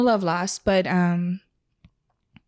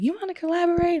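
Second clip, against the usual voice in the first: mock eager ask; 10 semitones higher, faster than usual. People now asking to collaborate.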